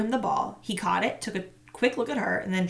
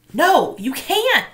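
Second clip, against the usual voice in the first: loud, slow, higher insistence; insisting; 9 semitones higher, 9 dB louder, much slower than usual. A narrator's reading. Boy insists girl must not tell the teacher. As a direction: insist hard. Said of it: "Marshall insisted".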